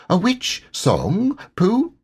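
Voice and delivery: high small Piglet voice. Acted. Piglet correcting Pooh, high and small. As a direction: squeak it out like a tiny piglet.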